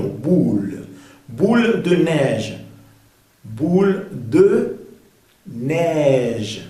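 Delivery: "boule de neige" slow, word by word; deliberate French model of "boule de neige"; well-defined U. Shown as a demonstration. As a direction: hold the "oo" in "boule" and draw out "neige". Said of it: "bien, bien definido".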